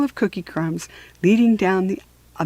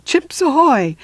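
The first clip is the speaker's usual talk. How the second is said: code call; 6 semitones higher, 5 dB louder than usual. Acted. Secret code shouted out.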